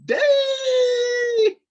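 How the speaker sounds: hoge, luide verraste uitroep; stunned shout. Retold or imitated. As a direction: roep het uit alsof je echt geschrokken bent. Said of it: "I was like".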